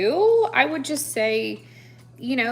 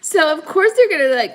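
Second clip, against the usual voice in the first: ordinary delivery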